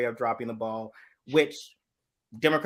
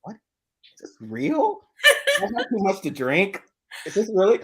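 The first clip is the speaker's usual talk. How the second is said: puzzled lion; 9 semitones higher than usual. Retold or imitated. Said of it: "He's like".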